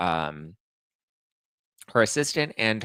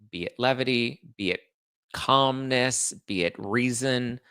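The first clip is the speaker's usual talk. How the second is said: ordinary talk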